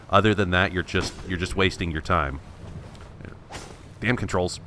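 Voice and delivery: usual speaking voice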